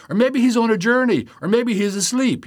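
high, loud sneering jeer at the false prophets; mocking taunt. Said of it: "he's mocking the prophets of Baal".

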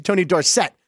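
Dorsett said dor-SETT; stress on second syllable. Shown as a demonstration. Host explaining the legal name change, stress on second syllable. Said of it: "emphasis on the second syllable".

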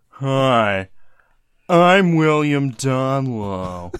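loud, drawn-out snooty voice; slow, pompous self-introduction from a stuffy man. Acted. Podcaster mocking William Donlow to the co-hosts, stuffy and snooty.

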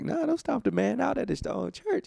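high retold protest; high-pitched protest. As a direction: push back in a high, incredulous voice. His own retort to angry people.

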